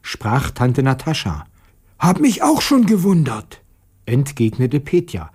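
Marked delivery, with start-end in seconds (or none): 2.0-3.6 high, lightly hoarse character voice; puzzled boy with a touch of hoarseness. A narrator's reading. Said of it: "ganz heiser geworden". (hoch und etwas heiser)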